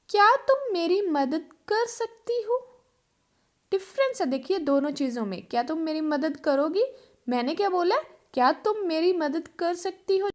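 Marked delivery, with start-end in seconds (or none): none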